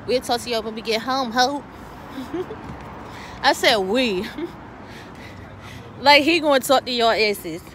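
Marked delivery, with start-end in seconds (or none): 1.7-3.4 airy laugh. Real, not acted. Laughs at own joke. 4.3-6.0 airy laugh. Real, not acted. Laughs at own joke.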